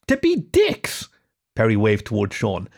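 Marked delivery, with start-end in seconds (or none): 0.1-1.1 very high, slow, squealing outburst; Perry's high voice, accusing his friends of spite; outraged rat. Acted. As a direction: squeal it out as a betrayal.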